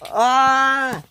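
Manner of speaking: long, high scream; sustained high cry of alarm; acted scream. A narrator's reading. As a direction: scream as you land in the sea. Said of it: "he screamed".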